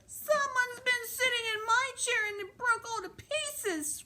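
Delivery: very high, wailing little bear; squeaky mock-crying baby bear. A narrator's reading. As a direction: wail like a tiny upset bear. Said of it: "cried the Baby Bear".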